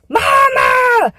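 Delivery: put-on a scared child calling out for her mother; retold yell for mom. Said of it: "And so finally I yell".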